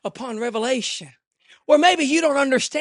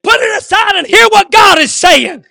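loud, high, ringing sermon cry; fiery pulpit preaching; full-force preaching; 8 semitones higher, 17 dB louder than usual. Acted. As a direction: preach it out to the whole church.

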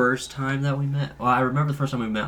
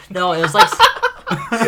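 laughing; 13 semitones higher, 12 dB louder, slower than usual. Real, not acted. Laughing while telling.

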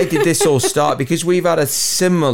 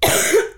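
man flu cough. Real, not acted. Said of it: "Man flu".

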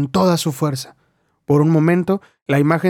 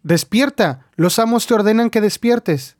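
mild mock shout; 7 semitones higher than usual. A narrator's reading. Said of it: "él chasqueó los dedos y gritó".

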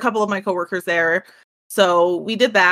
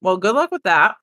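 normal talking voice

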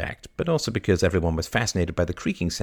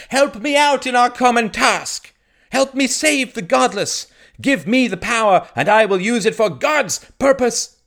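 impassioned sermon, pitched up and urgent; fervent preaching; 8 dB louder than usual. Acted. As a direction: preach it like a village priest saving souls. Priest preaching to the village.

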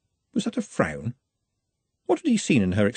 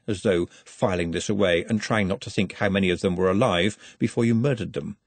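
usual speaking voice

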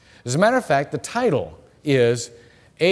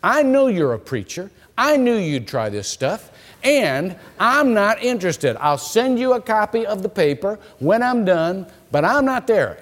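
imitating an indignant student, higher and emphatic; mock indignation, pitched up and insistent; 7 semitones higher than usual. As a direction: push back in a raised, affronted voice. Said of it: "She looked at me indignant".

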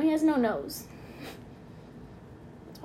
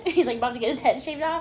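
ordinary talk

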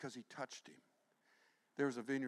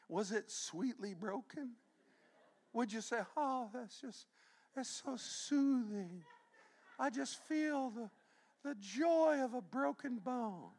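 mocking, slow, sugary sweetness, higher; mock sweet; 13 semitones higher, 5 dB louder, slower than usual. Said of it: "wimpy song ... tender, gentle, soothing".

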